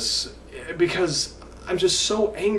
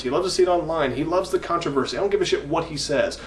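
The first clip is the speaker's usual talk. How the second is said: usual speaking voice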